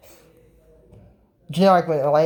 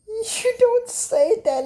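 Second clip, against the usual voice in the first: incredulous squeak; much slower than usual. Real, not acted. Reacting to the dream line.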